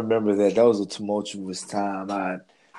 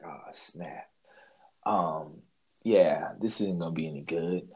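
low, hushed dread, voice dropped right down; quiet dread; 7 semitones lower, 6 dB quieter than usual. Retold or imitated. Kid realising he's busted, voice dropped low in dread. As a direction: mutter it low as the trouble sinks in.

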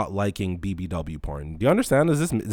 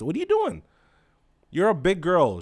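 much higher squeak of disbelief; incredulous; 8 semitones higher than usual. Real, not acted. Asking the woman in shocked disbelief. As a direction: shoot the question up high.

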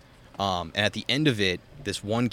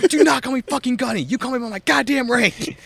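much higher, louder furious mock roar; imitated drill sergeant yell; 7 dB louder than usual. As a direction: do the gunny tearing into a recruit. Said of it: "Fucking grilling into this kid".

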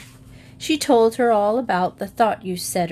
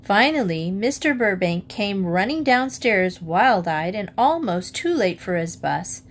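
ordinary delivery, nothing marked

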